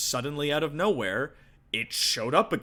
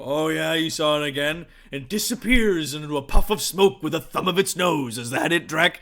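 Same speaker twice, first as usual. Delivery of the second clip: loud, higher, jeering disbelief; mocking orcs; 5 semitones higher, 8 dB louder than usual. Orcs tease Drek over his sighting, loudly mocking. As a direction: rib the scout with scornful mock agreement. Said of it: "most of the other orcs laugh".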